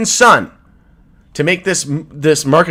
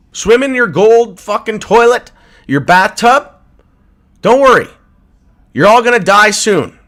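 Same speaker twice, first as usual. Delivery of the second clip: angry taunt; 6 semitones higher, 5 dB louder than usual. Real, not acted. Crypto streamer taunting Wall Street bankers, loud and furious. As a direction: spit it at them like a curse.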